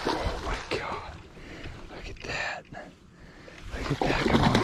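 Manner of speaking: hushed, breathless whisper; awed whisper. Real, not acted. Angler watching the fish, awed whisper. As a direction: whisper so the fish won't hear.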